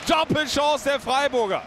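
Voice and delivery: high, rapid goalmouth scramble call; excited play-by-play. Acted. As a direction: call every save, building.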